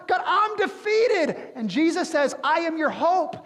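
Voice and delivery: loud, high, impassioned preaching; preaching at full force. Acted. Preacher to congregation, voicing cries to God, impassioned and loud. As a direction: build to a fervent pulpit climax. Preacher's call and response climax.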